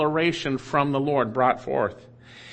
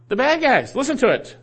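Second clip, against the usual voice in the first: loud warning call; 9 semitones higher, 4 dB louder than usual. Acted. Preacher addresses false prophets.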